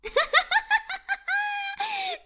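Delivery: high, loud, mean cat cackle; mocking cat laugh. Cat laughs at the mouse, high mean cackle.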